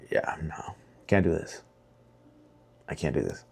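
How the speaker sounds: soft, near-whispered resignation; half-whispered, worn out and giving up; defeated mumble. Retold or imitated. Telling the boss he can't do this, hushed and defeated.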